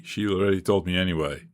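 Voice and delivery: ordinary delivery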